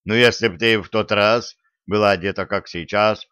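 plain reading voice

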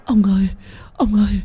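wife wailing over her drowned husband; keening lament. A narrator's reading. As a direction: mourn your husband in a loud lament. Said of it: "thì kinh hãi gào lên".

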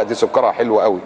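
normal talking voice

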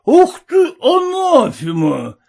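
put-on loud, slow drunken wail; drunken cry. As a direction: howl it like a drunk father. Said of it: "твердил отец и, шатаясь".

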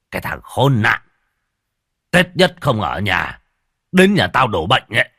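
loud, furious master; angry. A narrator's reading. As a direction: spit the words out in rage. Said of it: "Ông Tống Thực nổi giận". (giận dữ quát tháo)

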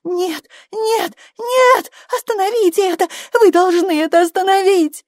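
high cries building to loud hysteria; escalating cries. A narrator's reading. Woman in labour begging them to stop it, escalating cries. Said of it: "возвышая голос с каждым новым криком".

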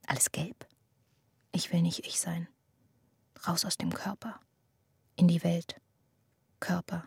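soft unvoiced whisper; whispered thoughts. Girl whispering her despair to herself. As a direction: barely breathe the words.

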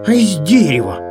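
ordinary delivery, nothing marked

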